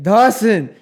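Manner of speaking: loud, high mock whine-yell; drunken friend's holler. Drunk friend in owl onesie demanding help, mock hollering. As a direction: holler drunken orders, stretched out. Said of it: "And he's like".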